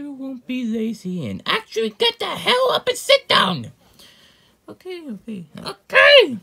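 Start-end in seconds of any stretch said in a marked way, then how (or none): 1.4-3.7 high, loud yell of exasperation; angry shout. Acted. Ordering the lazy ones to sit down and watch, angry shout. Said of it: "bring up your anger issues". 4.7-5.4 high, soft, placating okays; reluctant giving in. Acted. Answering the order to sit down, quiet giving in. 5.7-6.4 sudden high, very loud yell; angry outburst. Acted. Snapping after being told to sit down, angry shout. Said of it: "bring up your anger issues".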